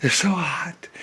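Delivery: a hint of a sweaty preacher; lightly voiced whining excuse; whiny excuse. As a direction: plead it weakly, fading. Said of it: "he'd be like".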